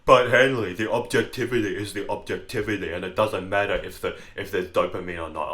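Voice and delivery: hint of a know-it-all listener; mock objector. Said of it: "you might say".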